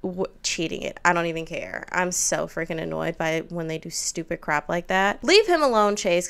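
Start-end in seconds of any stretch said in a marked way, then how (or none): 5.2-6.3 angry shout. Real, not acted. Yelling at sim Chase.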